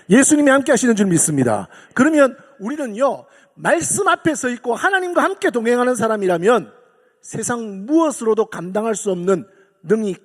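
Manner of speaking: loud, ringing sermon cadence; full-force preaching. Acted. Preacher rallies congregation, loud and fervent. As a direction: proclaim it to the whole hall.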